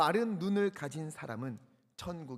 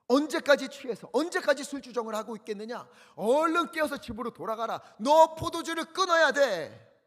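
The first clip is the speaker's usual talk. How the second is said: priest's rebuke; 8 semitones higher, 10 dB louder than usual. Retold or imitated. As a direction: rebuke her for being drunk. Eli rebukes Hannah, verse 14.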